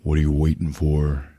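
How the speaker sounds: a sly taunt; mock creepy taunt. Possessed girlfriend taunting Jim, low mock taunt. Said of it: "And she just says".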